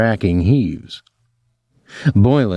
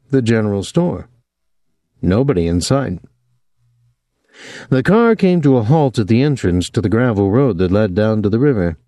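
ordinary delivery

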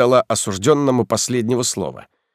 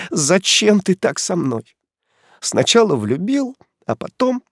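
hurt, tearful reproach; 5 semitones higher than usual. Acted. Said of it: "Чеканит по слогам".

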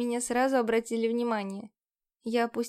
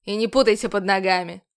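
louder, dismissive parting shot; rude; 3 semitones lower, 7 dB louder than usual. A narrator's reading. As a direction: toss it off rudely. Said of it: "невежливо бросил".

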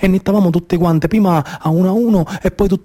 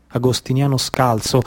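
ordinary delivery

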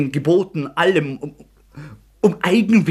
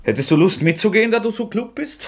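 higher, fast mocking jab; bitter sarcasm; 9 semitones higher than usual. Rodja mocking Sonja's offer, bitter and sarcastic. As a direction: sneer at her goodness.

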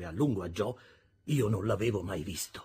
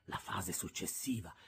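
plain reading voice

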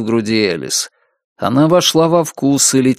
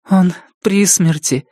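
sorrowful thought; 7 semitones higher than usual. A narrator's reading. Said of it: "думает Элис".

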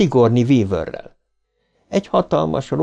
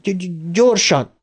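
stuttered urging; 8 semitones higher, slower than usual. A narrator's reading.

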